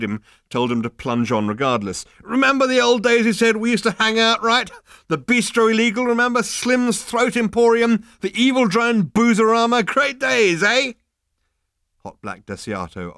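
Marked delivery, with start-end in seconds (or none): 2.2-10.9 high, loud tipsy chatter; slap-on-the-back drunken nostalgia; loud drunk reminiscing. Acted. Drunk Ford reminiscing loudly.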